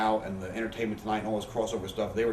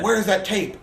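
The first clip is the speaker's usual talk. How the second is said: raised-voice demand, acting out an angry boss; retold angry yell; 10 semitones higher, 8 dB louder than usual. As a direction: imitate a boss hollering, loud and high. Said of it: "Vince is yelling at me".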